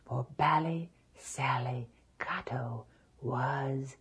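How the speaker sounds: soft, whispered storytelling; hushed narration. A narrator's reading. Storyteller hushes over the dead giant, softly whispered. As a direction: whisper it over the still body.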